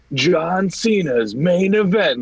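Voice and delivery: mock ring announcer, stretching every word; dramatic announcer. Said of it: "like, this dramatic".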